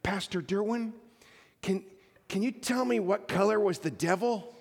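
lightly voiced small girl asking; little girl. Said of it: "little three or four-year-old girl".

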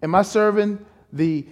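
ordinary talk

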